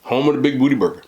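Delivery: a touch of a quoted character; lightly voiced movie line; movie quote voice. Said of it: "that quote is from".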